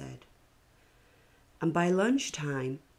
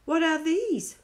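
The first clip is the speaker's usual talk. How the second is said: put-on very high, loud child's question; curious little girl; 13 semitones higher, 6 dB louder than usual. Noreen asking the class about the seeds, high and curious. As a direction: squeak it like a wide-eyed kid.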